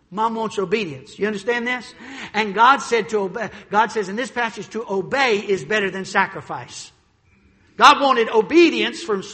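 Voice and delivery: usual speaking voice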